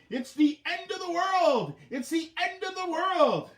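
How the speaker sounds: high, slow, hammed-up panicked yell; mock doomsday farmer cry. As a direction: wail it like a panicking old farmer. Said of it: "he was yelling".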